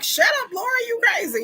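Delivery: high-pitched mock scolding; acted dismissive yell. Townsfolk dismissing the woman who warns, mock scolding. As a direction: shoot down the doomsayer in a high voice. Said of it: "And they're like".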